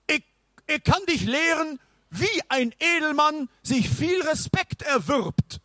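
high knight, hesitant start; stuttering knight. Acted. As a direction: stammer then press on. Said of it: "stotterte der Ritter".

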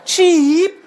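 high anxious little chirp; worried bird call. Acted. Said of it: "fragt natürlich auch ganz besorgt".

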